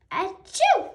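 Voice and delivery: loud high achoo; loud sneeze. Acted. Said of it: "sneeze more softly".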